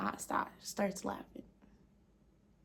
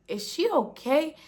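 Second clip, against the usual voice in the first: alarmed question; 7 semitones higher, 5 dB louder than usual. Retold or imitated. Kids alarmed by possessed aunt.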